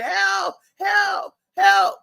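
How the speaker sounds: high, urgent cries of help; retold yelling for help. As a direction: call out for help like in a nightmare. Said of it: "he driving by us yelling".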